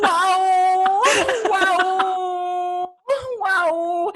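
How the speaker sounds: long, high, loud wail; drawn-out yowl held on one high note; weird wailing noise. Retold or imitated. Naked man in next cubicle, long loud yowling. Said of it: "this fucking noise".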